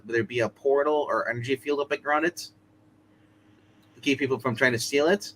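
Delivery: ordinary delivery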